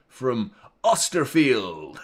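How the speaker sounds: put-on slow, drawn-out American accent. Host mocking a player's miss, sudden American accent. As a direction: stretch the name out in an American accent. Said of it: "Went with an American accent".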